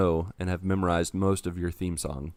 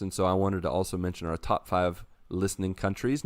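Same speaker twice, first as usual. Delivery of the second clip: ordinary talk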